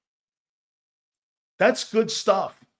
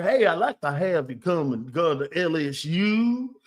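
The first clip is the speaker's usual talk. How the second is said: fake Cajun accent. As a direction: put on a phony Cajun recruiting pitch accent. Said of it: "phony-ass accents".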